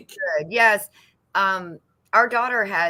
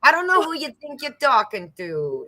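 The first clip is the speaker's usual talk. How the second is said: bad mock Italian mobster accent; hammed-up Italian godfather voice; 6 semitones higher than usual. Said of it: "my horrible Italian accent again".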